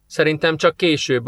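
ordinary delivery, nothing marked

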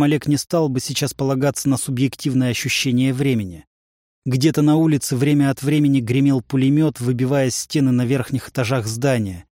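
ordinary delivery, nothing marked

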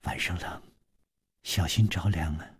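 a woman draping a coat on her man, softly caring; tender and soft. A narrator's reading. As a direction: tuck him in with your voice, gently. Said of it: "柔声说".

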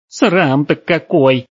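high, loud, drawn-out exclamation; scandalised old woman. A narrator's reading. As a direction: cry out in slow, scandalised dismay. Old woman exclaims at shocking sight.

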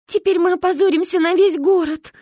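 high, loud distressed lament; acted tearful wail. A narrator's reading. Kristina fears the whole town's shame, high tearful wail. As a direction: wail it out as if in tears. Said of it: "заплакала Кристина".

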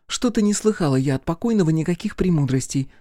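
put-on high, sceptical girl's voice; objecting. A narrator's reading. Girl contradicts the would-be philosopher, objecting. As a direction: push back in a high voice. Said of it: "возразила Оля".

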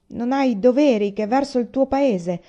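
insistent. A narrator's reading. Aiko presses his brother about duty, loud and insistent. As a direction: lean hard on him, demanding. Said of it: "insistette Aiko".

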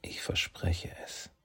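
slow, toneless whisper; emotionless whisper. A narrator's reading. Kitsune answers Saki's plea in an emotionless whisper. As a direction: breathe it without feeling. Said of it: "antwortet Kitsune emotionslos".

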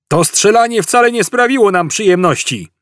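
high-pitched shouted complaint; calling out. A narrator's reading. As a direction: call your displeasure across the hall. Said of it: "zawołał drugi Glina". (wołanie z pretensją, wysokim głosem)

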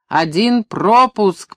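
slow, high, loud shout; strained yell. A narrator's reading. Funk insisting on a single pass, yelling hoarsely. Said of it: "проорал Фанк сорванным голосом".

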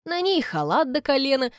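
ordinary delivery, nothing marked

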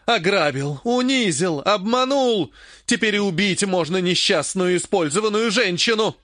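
high, theatrical accusations; wronged-woman indignation; aggrieved outburst. A narrator's reading. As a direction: pile up grievances, pitch climbing. Acted quarrel, injured woman lists wrongs.

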